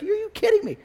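very high mock disbelief; incredulous. Pet store owner hearing the complaint, incredulous. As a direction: shoot the pitch up in disbelief.

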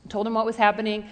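normal talking voice

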